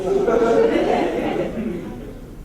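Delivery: a real airy burst of laughter; loud unvoiced laugh at his own refusal; breathy laugh. Real, not acted. Laughs after refusing his dad.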